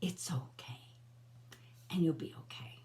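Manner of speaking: whispered, gentle comfort; hushed, tender promise; soft reassurance. Real, not acted. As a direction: soothe someone who is hurting. Comforting viewers about betrayal.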